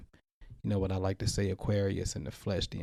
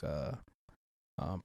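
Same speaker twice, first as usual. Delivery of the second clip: usual speaking voice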